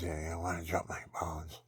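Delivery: woman whispering a proposition to him; whispered come-on. Retold or imitated. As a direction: whisper it like a seductive invitation. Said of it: "she says".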